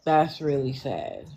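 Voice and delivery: low, subdued and saddened; somber sympathy. Real, not acted. Host hearing of a wife's death from cancer, somber sympathy. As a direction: let the news sink in, low.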